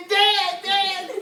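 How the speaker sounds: put-on high, loud childish "Dad, Dad!"; whiny child calling. Comedian voicing Bush crying for his dad, high and loud. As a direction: cry for daddy like a lost kid.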